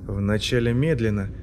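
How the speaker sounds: ordinary delivery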